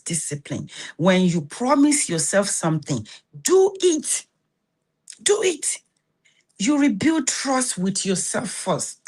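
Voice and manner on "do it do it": high, punched command; urgent insistence. Real, not acted. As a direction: push the viewer to act. Urging the viewer to commit.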